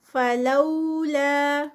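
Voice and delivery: فلولا recited very slowly with the لو drawn out; Quran recitation. Shown as a demonstration. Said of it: "لو پہ واو لین".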